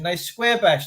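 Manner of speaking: usual speaking voice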